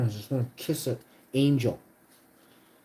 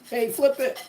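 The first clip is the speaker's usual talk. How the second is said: mock chat viewer; 13 semitones higher, 5 dB louder than usual. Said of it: "Someone was like".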